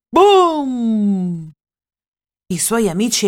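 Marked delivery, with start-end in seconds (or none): 0.1-1.5 playful boom, lightly voiced; a hammed-up shout to startle; mock loud shout. Elmer startling the sleeping herd, playful mock shout. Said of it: "urlò fortissimo".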